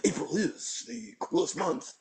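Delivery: bad William Shatner. Poet showing the Facebook group's Wasteland reading, bad Shatner accent. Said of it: "in a bad Shatner ac-, accent".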